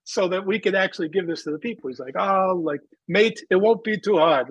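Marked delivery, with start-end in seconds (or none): 2.2-4.5 a bad, lightly done Australian accent, a bit higher; imitating the Australian editor Luke, a hint of Australian twang; horrible Australian accent. Quoting Luke's easy reply in a hammy mock Australian accent. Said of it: "I have a horrible Australian accent".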